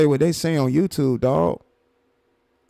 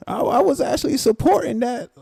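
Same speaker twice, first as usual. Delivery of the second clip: higher, mocking parrot of his words; mocking imitation; 10 semitones higher than usual. Streamer mocking the other guy's claim, in a higher voice. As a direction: mimic him to make him sound silly.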